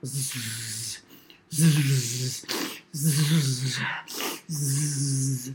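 low buzzing pretend snore; storybook buzzing snores; snoring. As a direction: drone a low, soft zzz of sleep. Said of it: "Amy snored".

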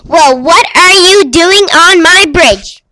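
yelled demand. Acted. Troll interrupting little goat on the bridge, yelling. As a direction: yell it as the troll, punching the words. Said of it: "yelled out".

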